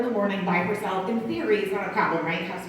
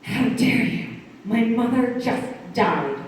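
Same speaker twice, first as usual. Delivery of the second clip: mock outrage; slower than usual. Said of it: "I look at her and I go".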